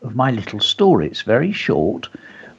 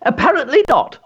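sniffy; 13 semitones higher than usual. A narrator's reading. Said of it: "sniffed his mum".

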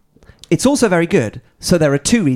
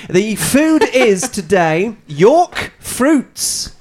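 higher, slow, drawn-out announcing; presenter announcement; 6 semitones higher, 3 dB louder, much slower than usual. Real, not acted. Host revealing today's food to friends, slow announcer voice. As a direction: unveil it slowly in a raised presenter voice.

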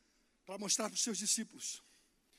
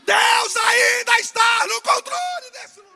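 slow, much higher and louder shouted preaching; full preaching shout; 12 semitones higher, 20 dB louder, slower than usual. Real, not acted. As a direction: hammer it out at the top of the voice. Clímax da pregação. (pregação gritada, lenta e muito alta)

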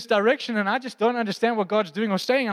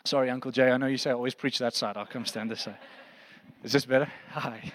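lower, much softer aside; offhand aside; 9 semitones lower, 6 dB quieter than usual. Real, not acted. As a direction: step away from the mic and chat. Aside to a congregant off mic.

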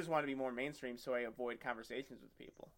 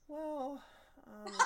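high, squeaky hesitant well um; awkward hedge; 13 semitones higher than usual. Retold or imitated. Lifter awkwardly answering a coworker about nationals. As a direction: stall awkwardly, pitched up.

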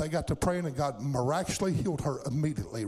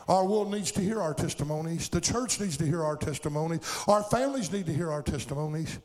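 ordinary delivery

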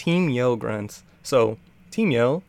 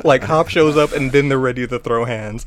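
ordinary talk

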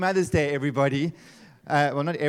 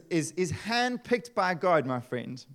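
ordinary delivery

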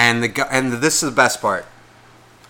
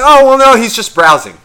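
high, loud brush-off that drops at the end; imitating a man protesting too much; loud mock protest; 9 semitones higher, 10 dB louder than usual. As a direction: blurt it out high, then come down. Acting out the boyfriend's protest.

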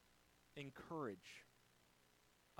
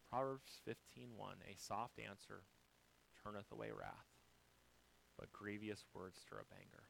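usual speaking voice

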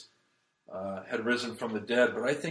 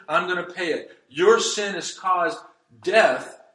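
building preaching; 4 semitones higher, 4 dB louder than usual. Acted. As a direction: hammer home each line harder. Preaches the cross at full force.

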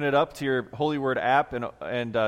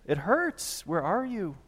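anguished plea; 6 semitones higher, slower than usual. Acted. Voicing a hurting believer's plea.

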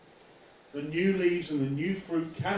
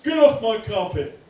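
loud, high, acted indignant shout; mock outrage; 7 semitones higher, 11 dB louder than usual. Preacher imitates people objecting to oil on carpet, outraged shout. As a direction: order them off like an affronted householder. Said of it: "other people go".

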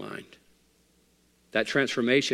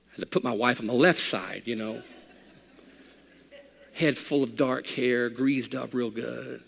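normal talking voice

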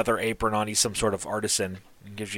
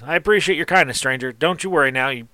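higher, louder folksy tradesman; a gruff man warming up, voice raised; grateful artisan; 3 semitones higher, 3 dB louder than usual. Acted. Artisan thanking the stranger for his kindness, higher voice. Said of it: "and he goes".

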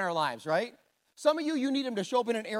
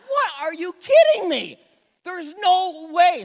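loud, high, slowing outraged disbelief; can't believe the card just fell; stunned disbelief; 10 semitones higher, 10 dB louder, slower than usual. Retold or imitated. Dad losing to his son at poker, loud stunned disbelief.

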